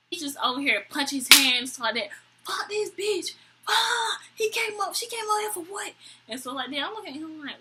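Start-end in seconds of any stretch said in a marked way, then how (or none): 2.4-5.9 high, strained imitation of the date cursing her out; mock angry rant. Date ranting about the girl, a mock whiny rage. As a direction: ham up his whiny outrage.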